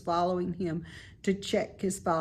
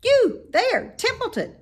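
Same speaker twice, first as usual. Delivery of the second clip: high, loud call across the yard; 8 dB louder than usual. A narrator's reading. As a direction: call the rat from afar. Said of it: "he called".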